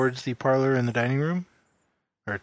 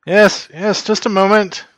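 calling loudly through a closed door; shouting through a door; 8 semitones higher, 11 dB louder than usual. Acted. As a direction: yell it through the door from the kitchen. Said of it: "Are you just shouting through the door?".

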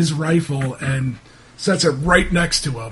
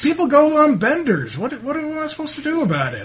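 higher-pitched, protesting character voice; flustered, higher-voiced townsman fending off blame; defensive local man; 9 semitones higher, faster than usual. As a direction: brush off the questions, pitched up and defensive. Game master plays irritated local.

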